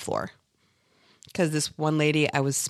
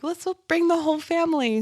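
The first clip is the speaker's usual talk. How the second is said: mock cheerful suggestion; 12 semitones higher than usual. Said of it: "I was like".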